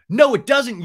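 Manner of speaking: loud, high snap back; mock heated contradiction. Lala contradicting Sandoval, loud mock snap.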